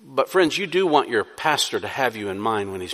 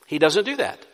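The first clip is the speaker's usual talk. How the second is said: ordinary talk, nothing marked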